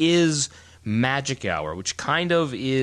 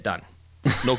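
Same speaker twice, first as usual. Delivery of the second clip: normal talking voice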